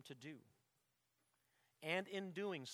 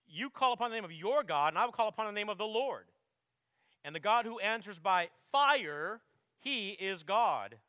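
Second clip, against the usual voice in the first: loud and ringing, slowing right down on the last words; bold challenge; 4 semitones higher, 11 dB louder than usual. Acted. Elijah challenging Baal's prophets, loud and defiant. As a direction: throw down the challenge, firmly.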